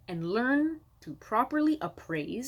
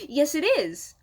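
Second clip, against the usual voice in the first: eager seller's reply; 7 semitones higher, 5 dB louder, slower than usual. Retold or imitated. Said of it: "you say".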